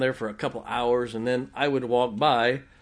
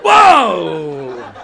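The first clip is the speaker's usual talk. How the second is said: big, loud yell of fright; booming startled whoop, higher pitched; reenacted startled scream; 5 semitones higher, 16 dB louder than usual. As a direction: holler it out as if grabbed in the dark. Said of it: "exactly what I did and scream".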